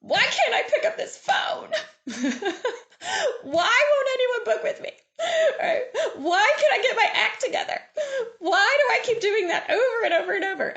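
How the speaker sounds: high, laughing mock whine of self-pity; whiny self-doubting voice. Seller mocking her own inner whine, high and laughing. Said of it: "So the bad why is".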